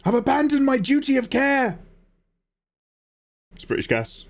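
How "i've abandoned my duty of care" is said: very high, melodramatic cry; hammed-up confession. Gas man in a boiler skit, hammed-up high wail. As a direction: wail it out theatrically.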